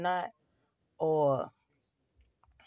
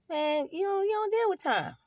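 incredulous protest; 12 semitones higher than usual. Retold or imitated. Retold pushback answering God.

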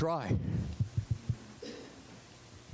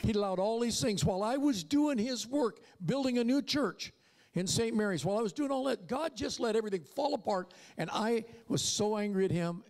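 impassioned preaching; 6 semitones higher than usual. Acted. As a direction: pitch climbing higher, pressing hard. Preaching at rising intensity.

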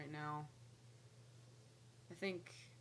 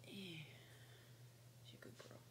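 slow soft whisper to a pet; 13 dB quieter, much slower than usual. Real, not acted. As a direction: breathe it out tenderly, slowly. Praising her snake softly.

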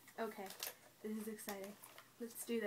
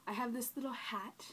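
normal talking voice

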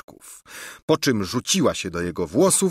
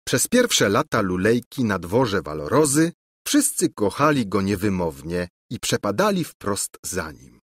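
plain reading voice

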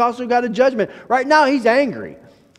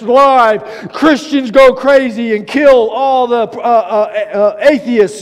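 louder, slower breaking-news announcer; hammed-up CNN live report; mock news anchor; 5 dB louder, slower than usual. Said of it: "you could get on C N N and be going like this".

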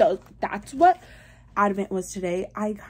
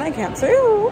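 high teasing voice; 12 semitones higher, 7 dB louder than usual. Pretend. Teasing secret.

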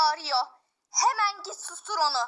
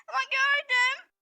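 put-on insistent child; 6 semitones higher than usual.

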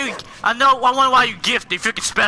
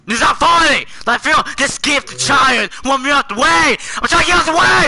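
angry shouting; 3 semitones higher, 5 dB louder than usual. Real, not acted. Stuck player to laughing friend, angry shouting. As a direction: shout it in real frustration, building.

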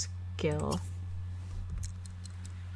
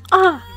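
sudden high loud yelp; startled yelp; 11 semitones higher, 7 dB louder than usual. Real, not acted. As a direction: jump at the sight of the slap. Watching a fight break out.

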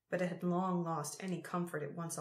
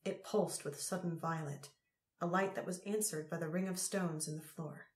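plain reading voice

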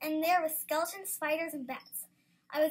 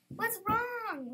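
mild, higher-pitched shout; 6 semitones higher than usual. A narrator's reading. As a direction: call out with a touch of alarm. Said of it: "Lily and Bella both shouted".

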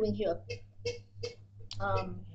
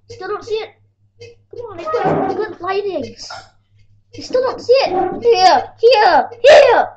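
louder and higher, frustrated; insist, getting louder each time; exasperated calling out; 7 semitones higher, 12 dB louder than usual. Real, not acted. Showing hand to camera, exasperated.

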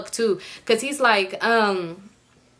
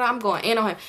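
usual speaking voice

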